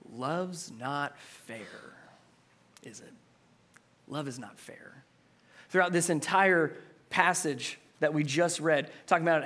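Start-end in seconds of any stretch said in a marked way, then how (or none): 0.1-5.0 hushed emphasis. Real, not acted. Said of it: "sermon point delivered softly".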